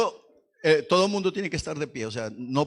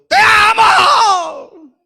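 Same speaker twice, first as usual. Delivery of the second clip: very high, long drawn-out yell; absurd love shout; 17 dB louder, much slower than usual. Pretend. Preacher mocking a lover yelling at midnight, huge shout. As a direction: bellow a love declaration at full volume. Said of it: "ninguno a esa hora está gritando".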